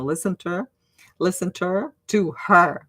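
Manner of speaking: Listen to her said as listen-to-er, no H in her; dropped H. Shown as a demonstration. Teacher showing that nobody says the H in her. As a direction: link to her as to-er, no H. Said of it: "Nobody says the H".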